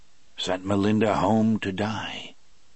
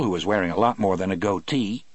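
ordinary delivery, nothing marked

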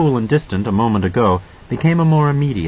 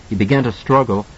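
ordinary delivery

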